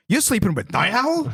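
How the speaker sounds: high-pitched, incredulous mock shock; comically dumb surprise. Manhattan finding out about the affair, comically dumb surprise. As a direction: squeak it like a dim cartoon character. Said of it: "it comes off like a comically dumb character".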